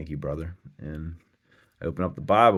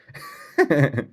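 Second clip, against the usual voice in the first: real loud laugh; 11 semitones higher, 9 dB louder than usual. Real, not acted. Laughing loud at his own punchline to the viewers. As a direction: burst of high laughter.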